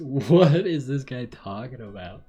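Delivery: loud, laughing outburst; laughing disbelief. Real, not acted. Laughing at the bizarre letter.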